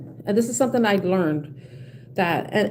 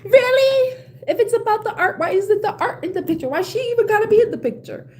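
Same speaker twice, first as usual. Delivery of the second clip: much higher, incredulous mock-outrage; heated disbelief; 11 semitones higher than usual. Telling her friend off about the art excuse, incredulous. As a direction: demand it like he can't be serious. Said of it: "it still gets me heated".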